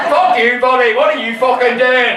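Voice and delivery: loud mock-aggressive challenge; hammed-up angry yell; mock confrontation. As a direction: shout it like a comic stranger picking a fight. Mock American confrontation, played loud.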